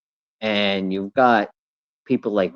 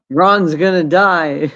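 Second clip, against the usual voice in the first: blurted out through real laughter, louder; laughing; 7 semitones higher, 6 dB louder, slower than usual. Real, not acted. Guest cracking up about Ron, laughing. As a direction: laugh the words out as you say them.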